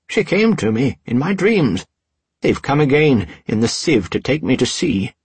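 high, loud cry out of nowhere; sudden shout. A narrator's reading. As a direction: blurt it out wildly. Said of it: "the old man shouted suddenly".

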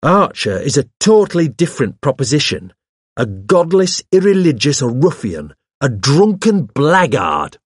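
high, emphatic condemnation; indignant denunciation. Acted. Hawes denounces a suspect to the vicar, indignantly. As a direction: spit out each insult with rising outrage.

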